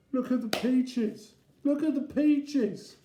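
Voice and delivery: put-on high, slow child's lament; wailing child. Joe walking around after the crash, high child's wail. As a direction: keep it high and drawn out.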